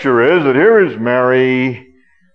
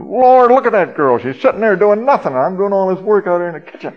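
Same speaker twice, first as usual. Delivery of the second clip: high, fast, mock-indignant complaint; exasperated outburst; 6 semitones higher, faster than usual. Martha complaining to the Lord about her sister, high, fast, mock-indignant. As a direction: rattle off a hammed-up grievance about her sister. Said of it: "he whirled around and said".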